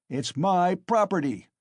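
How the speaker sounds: loud, high furious shout; angry shout. A narrator's reading. Mikolka defending his right to beat the mare, furious. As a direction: bark it defiantly at the crowd. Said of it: "shouted Mikolka".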